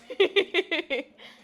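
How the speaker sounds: teasing laugh. Real, not acted. Teacher teasing class who asked for this, teasing laugh. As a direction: laugh at their expense, playfully.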